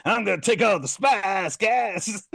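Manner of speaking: high, loud [name] shout; Chalamet's [name]. Retold or imitated. Chalamet grabbing the spice in his [name] voice, shouting. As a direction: bellow it like a stoned [name]. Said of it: "Timmy Chalamet was like".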